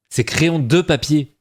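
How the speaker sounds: drawn-out correction with a heavy "de"; stress on "de". Shown as a demonstration. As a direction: insist slowly, punching the word "de". Insists again on own variant.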